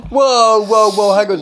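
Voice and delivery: loud, high alarmed whoa, cutting her off; startled whoa. Real, not acted. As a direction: stop her in her tracks, shocked. Father stops daughter's story.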